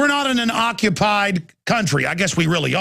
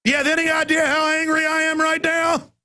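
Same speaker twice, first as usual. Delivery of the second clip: furious shout; 8 semitones higher than usual. Real, not acted. Radio host ranting to listeners about paying for parking, furious shout. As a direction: yell it out, pitch cracking with fury.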